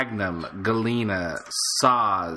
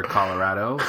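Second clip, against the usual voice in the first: ordinary delivery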